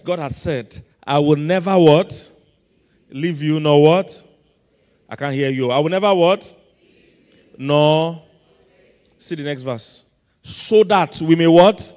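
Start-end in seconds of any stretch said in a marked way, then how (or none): 1.0-8.2 preacher's loud, demanding call-and-response; loud prompting. Real, not acted. Preacher makes congregation finish the verse, loud prompting. 10.4-11.9 slow, loud lead-in to a shouted question; loud prompting. Real, not acted. Preacher cues congregation for next verse, loud prompting.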